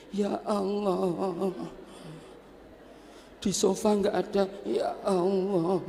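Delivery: quiet despairing sigh to God; despairing mother. Acted. Mother finds no water on the hill, soft lament.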